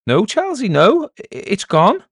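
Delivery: high, loud, astonished answer; amazed. Acted. Villager telling the elder the noise has stopped, amazed. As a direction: stammer out the news in wonder.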